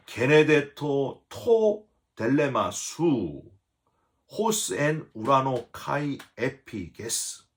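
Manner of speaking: slow Greek recitation, 게네데토 토 델레마 수 each syllable even; reading the Greek. Shown as a demonstration. Pastor reading the Greek prayer line aloud to the church, slow and careful. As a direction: read the original Greek slowly. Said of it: "원어 문장을 보시면은요".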